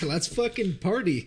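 high-pitched retold party cry; mock rallying the group to party; whooped-up party call with a laugh; reinvigorated. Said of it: "reinvigorated. Everyone's like".

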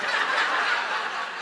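a voiceless, breathy burst of laughter; breathless laugh. Real, not acted. Pastor laughs telling congregation about being in his underwear. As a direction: laugh helplessly at the memory.

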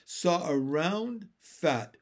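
ordinary delivery

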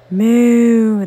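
a loud, mock moo; cow's cry. The cow cries out in the tub: mock moo. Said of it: "the cow cries".

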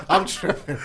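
unvoiced wheezing laugh; laughing at his own mistake; breathless laugh. Real, not acted.

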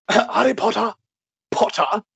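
"Potter" pitched high with a rising end; put-on high "Harry Potter", then "Potter"; silly name pronunciation. Quizmaster playing with the name, "Harry Potter" said high and silly. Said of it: "calling him like this".

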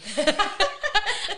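a burst of real loud laughter; big rueful laugh. Real, not acted. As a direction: laugh out hard at yourself. Laughing at her own aversion.

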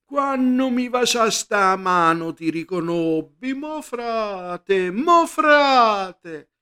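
high, slow Sicilian puppet cry; shouting puppeteer. Acted. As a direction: wail it out like a pupo on stage. (grido del puparo in siciliano)

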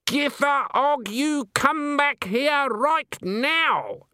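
very high, drawn-out furious call; angry shout. Acted. Granny yelling at Nanny halfway down the path, furious shout. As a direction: summon her back, word by word.

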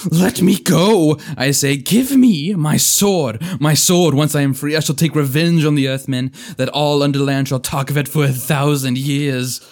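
loud, high frenzied shriek. Acted. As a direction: scream in wild frenzy. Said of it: "rising into a shriek".

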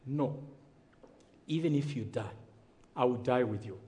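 low, hushed retelling of a vow, with long pauses; solemn quiet pledge. Retold or imitated. Friend vows to die with him in the bush, hushed and solemn.

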